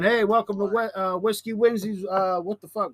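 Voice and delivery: excited radio greeting; excited host. Real, not acted. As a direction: hype up the caller.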